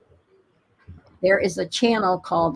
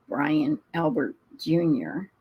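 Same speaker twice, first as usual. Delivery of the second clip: ordinary talk, nothing marked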